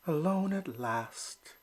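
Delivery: slow, soft relief; to himself. Acted. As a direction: sigh it out to yourself. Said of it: "he said to himself".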